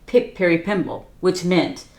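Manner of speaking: ordinary delivery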